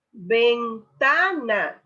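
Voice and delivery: ventana drawn out slowly with ta stressed; slow stressed word. Shown as a demonstration. Teacher slowly sounds out ventana to show the stress. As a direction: stretch ventana and lean on ta. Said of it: "la sílaba ta es la tónica".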